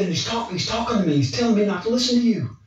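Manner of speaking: high-pitched rattled telling; a man unnerved by a voice only he hears; spooked. Retold or imitated. Client describing the demon talking to him, high rattled tone.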